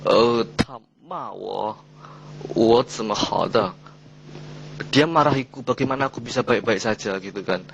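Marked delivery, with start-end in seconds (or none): 0.6-3.7 "Ta ma wo, wo zime hao de" spoken very slowly with each falling tone pressed hard; slow, deliberate falling-tone demo; emphatic fourth tones. Shown as a demonstration. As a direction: lean on every "ma" and "wo", slow and weighted. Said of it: "kita harus menekankan seperti contoh gini".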